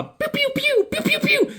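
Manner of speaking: very high pew pew zaps; laser sounds. Retold or imitated. Baxter Building defenses firing lasers, high zaps. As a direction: fire off a string of laser zaps.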